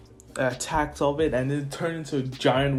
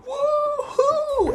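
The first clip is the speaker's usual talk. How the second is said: long falsetto cheer; thin, gleeful "woohoo"; high whoop; 4 dB louder than usual. Acted. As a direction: whoop up high like a soaring hero. Hero whooping as he dives.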